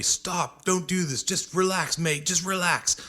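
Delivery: retold bouncer's urgent plea, pitched high; pleading bouncer. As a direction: imitate a bouncer urging someone to back off. Bouncer urging him to calm down.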